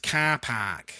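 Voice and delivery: flat-vowelled Harborough "car park"; lightly shown Harborough accent. Shown as a demonstration. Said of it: "Harborough has an accent in itself".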